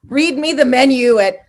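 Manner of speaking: loud, high swooning demand; gushing mock plea. Fan begging an adored host to read aloud, loud gushing plea. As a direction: cry it out like a besotted fan.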